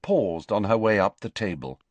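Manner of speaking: ordinary talk, nothing marked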